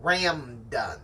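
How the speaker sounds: "Ramdun" said slowly, M and N swapped; misread word. Shown as a demonstration. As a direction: sound out "Ramdun" as a mistaken random. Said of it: "instead of random".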